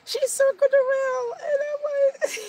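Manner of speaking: high laughing squeal of delight. Real, not acted. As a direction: laugh through the words, delighted. Laughing, thrilled about a fan passenger.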